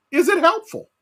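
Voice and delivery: "Is it helpful?" pushed up high and loud; emphatic question. Acted. Preacher drives home the question.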